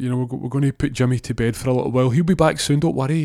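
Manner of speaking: high-pitched, flustered dad; anxious reassurance. Acted. Ron covers up the shooting for his son, anxiously reassuring. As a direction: stammer through a hurried cover story.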